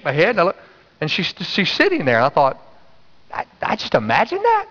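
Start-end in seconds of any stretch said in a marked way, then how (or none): 3.3-4.7 high-pitched, doubting double take; acted disbelief. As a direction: question your own eyes, voice jumping up. Preacher acts out his own disbelief.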